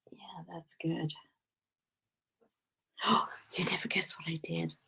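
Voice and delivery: soft unvoiced whisper. Real, not acted. Whispering to viewers.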